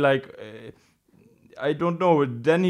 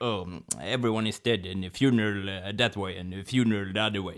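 low, soft, halting voice; mafia boss; 8 semitones lower, 9 dB quieter than usual. Retold or imitated. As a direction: play the subdued old boss at the end. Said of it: "it ends with him like".

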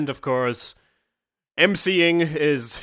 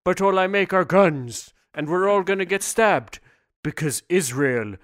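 hammed-up, louder, higher doom poem; mock dramatic poem recital; 3 semitones higher, 3 dB louder than usual. Improvising a poem with a friend, hammed-up recital. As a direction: declaim it like a silly tragic bard. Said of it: "do a poem with me".

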